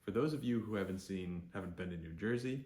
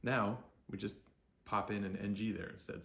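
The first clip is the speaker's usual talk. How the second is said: ordinary talk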